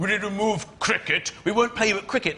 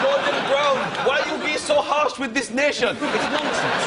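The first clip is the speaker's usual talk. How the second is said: Mugabe; 6 semitones higher than usual. Acted. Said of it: "it went a bit West Indian".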